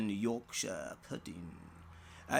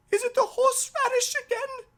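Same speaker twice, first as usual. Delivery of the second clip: put-on high, fast, loud child's voice; little girl's question; 11 dB louder, much faster than usual. Daughter asking daddy about the horseradish, high and quick. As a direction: rattle it off like a curious small girl.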